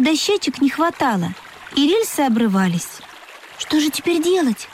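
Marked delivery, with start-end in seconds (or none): 3.6-4.7 high-pitched worried little engine; upset. A narrator's reading. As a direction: fret mildly in a little train's voice. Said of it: "расстроился паровозик".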